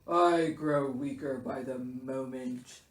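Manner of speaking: low, self-pitying bear voice; pitiful, weakening Bear. A narrator's reading. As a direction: moan as if fading away.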